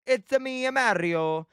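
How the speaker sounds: playful sing-song mock-Italian voice; Mario impression. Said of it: "The only one I can do".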